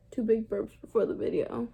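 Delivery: ordinary talk, nothing marked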